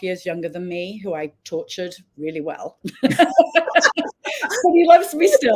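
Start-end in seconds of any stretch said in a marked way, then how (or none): 3.0-5.6 high, loud words through real laughter; laughing. Real, not acted. Guest jokes about having tortured her younger brother, laughing. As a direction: laugh your way through the line.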